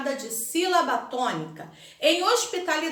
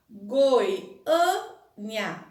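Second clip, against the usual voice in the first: "Goiânia" slowly in syllables, Â emphasized; stress on Â; 3 semitones lower than usual. Shown as a demonstration. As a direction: split "Goiânia" and lean on the Â. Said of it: "destaquem a sílaba pronunciada com mais intensidade".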